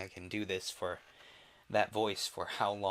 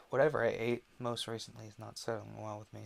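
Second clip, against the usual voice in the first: ordinary delivery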